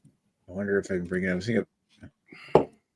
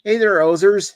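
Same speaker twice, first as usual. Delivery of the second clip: higher, hammed-up Canadian hoser voice; 10 semitones higher, 6 dB louder than usual. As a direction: lay the Canadian accent on thick. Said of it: "why is it so Canadian?".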